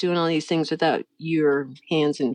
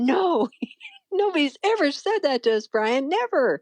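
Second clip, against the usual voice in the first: high-pitched retold protest with laughs breaking in; adamant protest; 7 semitones higher than usual. Wife arguing with her husband over the warning, high adamant protest. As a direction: insist in a high, incredulous voice.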